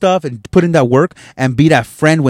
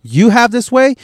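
higher mocking imitation of the friend; 10 semitones higher than usual. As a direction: lampoon her, pitched well up.

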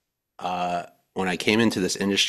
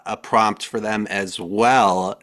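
ordinary talk

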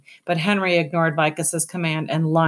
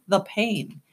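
usual speaking voice

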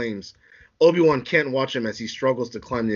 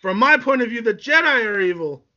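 high, loud, defiant protest; heated retort; 9 semitones higher, 4 dB louder than usual. Acted. Anakin argues the Jedi are evil, high heated retort. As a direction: throw it back at them, pitched high.

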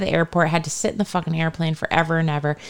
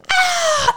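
retold scream. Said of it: "And I was like".

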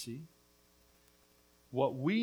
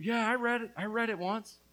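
mocking quote; 9 semitones higher, 6 dB louder than usual. Mocking people who read once.

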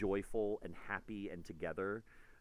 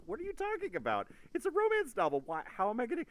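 baffled; 5 dB louder than usual. Retold or imitated. Author baffled by friend.

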